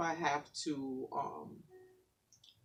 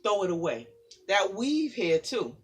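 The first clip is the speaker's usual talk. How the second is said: rhythmic spoken word, building in force; poem recital; 4 semitones higher, 10 dB louder than usual. Acted. Guest reading her poem to the audience, building spoken word.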